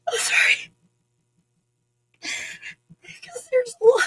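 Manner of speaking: fighting back tears, voice cracking; choked up. Real, not acted. Grateful viewer moved to tears, voice breaking. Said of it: "I'm not crying".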